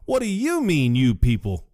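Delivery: an indignant echo, lightly voiced; Brandon Jackson voice.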